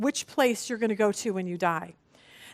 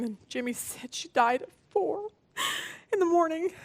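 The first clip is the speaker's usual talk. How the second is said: real tears; 4 semitones higher, 5 dB quieter than usual. Real, not acted. Retelling the friend's death.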